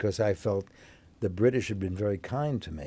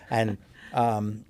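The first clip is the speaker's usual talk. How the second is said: normal talking voice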